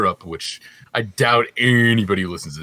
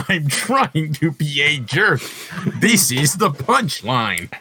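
very slow, higher-pitched mock delivery; mocking joke delivery; 5 semitones higher, much slower than usual. Co-host imitating friend's joke-telling, slow exaggerated mockery. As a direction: drag out each word to parody him. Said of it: "is that how you deliver your jokes?".

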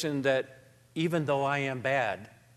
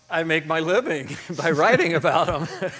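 louder, laugh breaking into the words; laughing admission; 3 semitones higher, 6 dB louder than usual. Real, not acted. Writer answers how he handles faith's blurry edges, laughing admission. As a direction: joke about your own job through real laughter.

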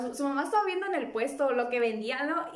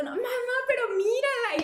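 high-pitched imitated child's whine; hammed-up childish hysteria; hysterical child; 11 semitones higher than usual. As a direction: act a frantic kid pestering mum. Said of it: "el niño empezó así bien histérico".

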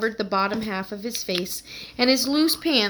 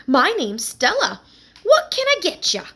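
brassy New York shop lady, much higher; friendly New York counter greeting, pitched up; New York accent; 9 semitones higher, 5 dB louder than usual. Acted. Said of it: "her New York accent".

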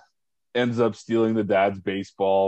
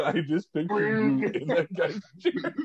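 laughing; 9 semitones higher than usual. Real, not acted. Laughing at Kevin's story.

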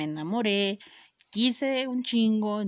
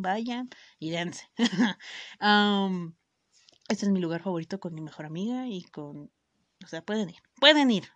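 usual speaking voice

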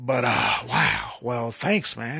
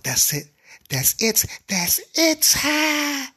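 rising higher each time, ecstatic; building to climax; 14 semitones higher, slower than usual. Acted. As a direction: climb from plain to a high squeal. Woman reaching climax.

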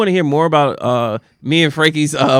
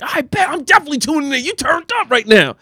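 excited; 12 semitones higher than usual. Retold or imitated. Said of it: "And I'm like".

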